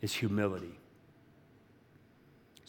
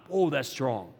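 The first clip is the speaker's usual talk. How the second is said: ordinary delivery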